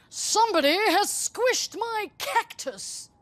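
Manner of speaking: high, slow outraged dad; indignant father. A narrator's reading. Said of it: "said the daddy person".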